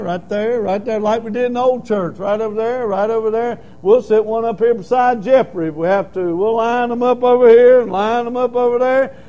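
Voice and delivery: loud, rising preacher's call; preaching. Acted. Pastor urging the congregation to make room, preaching. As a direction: drive the point home to the pews.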